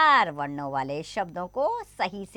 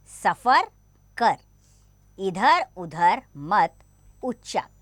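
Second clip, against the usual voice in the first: slow, word by word, a little louder; slow careful reading; 4 dB louder, much slower than usual. Shown as a demonstration. Teacher reads practice lines slowly to children. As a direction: read each line slowly for the class. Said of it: "कुछ पंक्तियां पढ़ते हैं".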